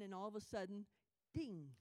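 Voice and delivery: ordinary delivery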